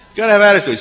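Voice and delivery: put-on high, loud, swaggering kid voice; cocky big brother. The older boy schools his little brother, high and loud with swagger. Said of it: "he does this".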